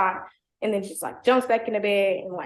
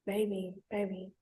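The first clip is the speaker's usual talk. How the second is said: lightly mocked boyfriend croon; cringey baby talk; 3 semitones lower, 12 dB quieter than usual. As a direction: imitate a cringe sweet-talk. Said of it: "very cringily".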